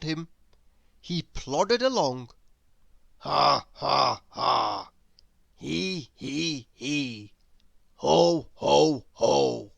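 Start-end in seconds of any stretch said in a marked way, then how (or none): 3.2-9.7 low, dull ha he ho; joyless practice laugh. Acted. Grizzle practising laughing alone on a walk, joyless. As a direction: plod through the laughs glumly. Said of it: "less like laughing".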